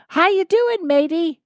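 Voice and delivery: high, cheery Irish "matey" greeting; mock Irish sprite saying hello; Irish leprechaun voice. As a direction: play a grinning little leprechaun. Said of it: "smiled at him and goes".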